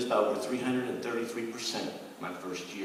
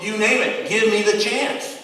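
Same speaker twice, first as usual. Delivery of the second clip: rising, louder, slowing on the last words; eager plea; 8 semitones higher, 8 dB louder than usual. Retold or imitated. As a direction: sell yourself with fire. Pitching himself passionately to boss.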